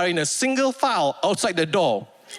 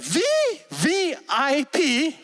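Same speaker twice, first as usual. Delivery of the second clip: slow, high, disbelieving repeat; incredulous echo; 9 semitones higher, much slower than usual. Retold or imitated. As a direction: stretch it out in disbelief. His own incredulous echo.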